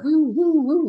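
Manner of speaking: high, loud playful whooshing woos; mock dodging woos. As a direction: dodge each kick with a teasing woo.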